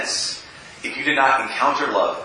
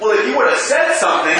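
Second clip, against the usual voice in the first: defensive brother; 7 semitones higher than usual. Retold or imitated. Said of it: "my brother's like".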